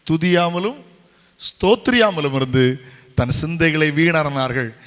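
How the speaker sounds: loud, forceful verse proclamation; preacher declaiming the Bible at full volume; emphatic scripture quoting. Real, not acted. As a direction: hammer the verse out, slowing as you go. Quotes scripture emphatically to congregation.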